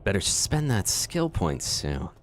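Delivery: ordinary talk, nothing marked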